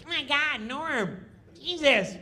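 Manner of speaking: high, nagging needling voice; imitating Kattan's high taunting nag; mocking Kattan. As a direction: pick at Norm in a high whine. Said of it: "he started busting on Norm".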